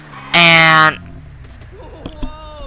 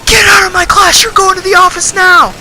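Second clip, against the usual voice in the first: furious shout; 6 dB louder than usual. Retold or imitated. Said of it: "And he's like".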